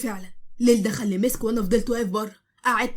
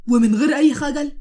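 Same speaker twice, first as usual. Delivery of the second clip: teasing echo of his sister's complaint; mock outraged echo; 3 semitones higher, 7 dB louder than usual. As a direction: repeat her phrase in hammed-up shock.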